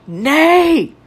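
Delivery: high-pitched mock yell; imitated cry. Friend stops teller bolting for back door, high imitated cry.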